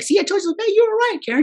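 mocking nurse. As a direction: chirp like a smug nurse. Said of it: "She's like".

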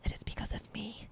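whispered boast; proud whisper. Real, not acted. Whispering to viewers that the garden's success is hers. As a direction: whisper the credit to yourself, pleased.

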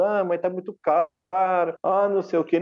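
a half-hearted whiny client grumbling about the price; mock whining. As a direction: customer haggling, imitated in a mildly whiny tone. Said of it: "Ele não fica choramingando, reclamando".

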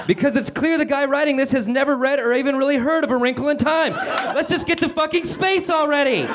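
high, strained character voice; exasperated rant. Acted. Calvin complaining about the writer and urging Meg onward, high exasperated rant. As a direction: rant in a high, impatient pitch.

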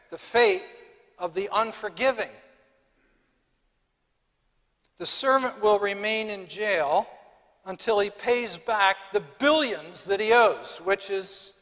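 loud, driving sermon climax; emphatic preaching. Acted. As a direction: bring the warning down hard. Preacher stresses eternal punishment.

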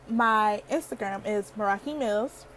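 ordinary talk

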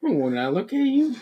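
high, slow, drawn-out mock flirting; mock pickup line. Friend starting another joke pickup line, mock flirty. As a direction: croon the corny opener up high.

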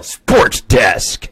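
hammed-up sports announcer catchphrase; sarcastic sports announcer. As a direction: boom it out like an overdone TV sportscaster. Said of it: "very overly sarcastic sports announcer".

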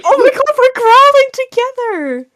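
very high, delighted outburst; excited squeal. Real, not acted. Friend thrilled at growling together, excited squeal. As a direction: squeal with sudden glee.

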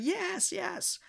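very high squealed imitation, soft in volume; mock screamed yes. Girl accepting a ride home, mock screamed yes. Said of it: "because she was screaming".

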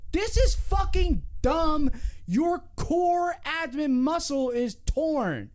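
high, slow, emphatic imitation; acted exasperated scolding. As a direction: play someone talking sense into a player. Acting someone scolding the injured player.

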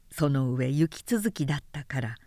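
ordinary delivery

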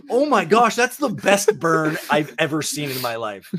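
delighted gush. Retold or imitated. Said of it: "And I'm like".

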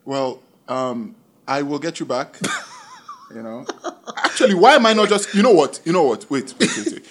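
ordinary delivery

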